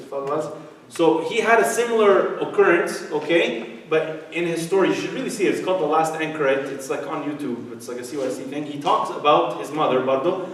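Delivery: ordinary talk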